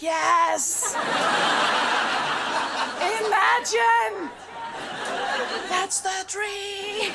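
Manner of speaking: slow, high, laughing delight, drawn out; mock dreamy glee, laughing; gleeful yes. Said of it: "And I was like".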